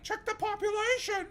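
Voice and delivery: high-pitched mock scream at the screen; strained, very high imitation of yelling viewers; retold screaming. Viewers screaming in chat at a streamer, mock scream. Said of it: "people were screaming in the chat".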